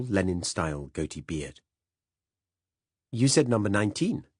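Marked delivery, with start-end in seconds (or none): 3.1-4.3 defensive and raised; protesting, defending himself. A narrator's reading. Young cop answering the detective: defensive, raised. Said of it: "said the young policeman defensively".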